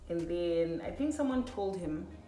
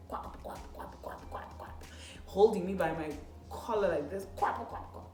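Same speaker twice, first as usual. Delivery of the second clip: slap sounds. Retold or imitated. Imitating slaps.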